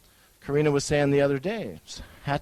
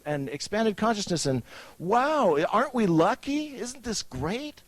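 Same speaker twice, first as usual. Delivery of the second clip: high, gushing wide-eyed wonder, hammed up; mock excitement; 7 semitones higher than usual. Mocking the researchers who gush over mushrooms, giddy hammed-up wonder. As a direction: play the giddy discoverer, oohing over mushrooms.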